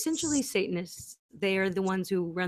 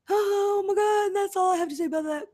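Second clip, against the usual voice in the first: high squeal; 13 semitones higher, 4 dB louder than usual. Acted. Grief over dogs then deflecting.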